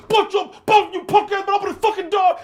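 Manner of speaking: very high, loud mock raging yell; bully's threat. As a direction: holler threats at a locked door as the bully. Acted bully yelling at locked door.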